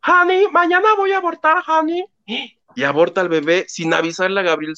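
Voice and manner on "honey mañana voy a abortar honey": high, put-on gringa voice; sing-song the fake American daughter; mock gringa voice. Telling the nurse about the abortion in a mock gringa voice. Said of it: "así de".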